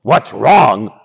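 low lion voice, indignant; grumpy lion. Acted. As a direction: throw the question back as a grumpy lion. Lion throws mouse's question back.

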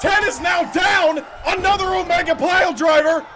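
high, fast rising wrestling call; excited play-by-play. Acted. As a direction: call the action as it explodes.

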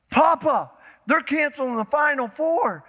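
put-on a kid breathless with big news; urgent grandson. Grandson phoning grandpa again, urgent and excited. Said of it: "Hung up".

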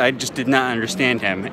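ordinary delivery

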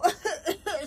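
short acted laugh; teasing giggle. Niece finds uncle's house smoking, acted giggle.

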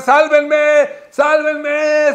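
mock desperate shout for rescue; retold cry for help. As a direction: yell for the police to hear. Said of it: "empezó a gritar".

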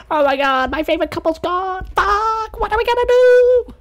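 distraught superfan, overplayed; hammed-up high sobbing panic; mock wailing. Fake fan devastation.

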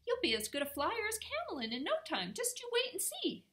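high, quick, fussy bat voice; excitable little creature, pitch soaring; Timmerie fussing. A narrator's reading. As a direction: gush encouragement excitedly. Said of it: "he fussed".

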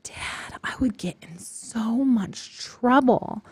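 hushed whispered protest to dad; a scared kid whispering. Retold or imitated. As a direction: whisper it as if afraid of trouble. Retelling reply to dad.

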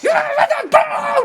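very high, slow, loud drawn-out shout; scary wife yell. Retold or imitated. Wife yelling at husband to stop, high drawn-out shout. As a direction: bellow to shut him up. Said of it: "막 이러는데 막 무서운 거야".

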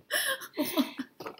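short embarrassed laugh. Real, not acted. Frustrated about lost sound.

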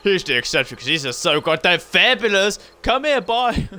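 high, sassy camp delivery; camp flamboyant voice. Pretend.

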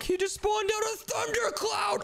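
very high, drawn-out squeal; alarmed amazement. Acted. Showing brother the monster's thundercloud, high shocked squeal. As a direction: squeal it slowly in disbelief. Startled by monster's new power.

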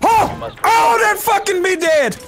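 real stunned shout; shocked yell. Real, not acted. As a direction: shout at the flick that killed you. Killed by a flick shot.

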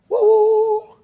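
high sudden whoop of discovery; excited whoop. Retold or imitated. Husband realising what upset his wife, excited whoop. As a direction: burst out as it dawns on you.

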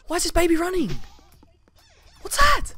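very high, loud shriek; terrified scream. Real, not acted. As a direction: scream in horror. Baby monster running at him.

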